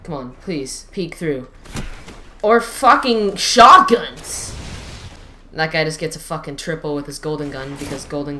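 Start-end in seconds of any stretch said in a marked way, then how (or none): 2.4-4.6 drawn-out furious yell, higher and louder; angry outburst. Real, not acted. Gamer yelling at enemy shotguns, slow furious outburst. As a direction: blow up at the shotgun players.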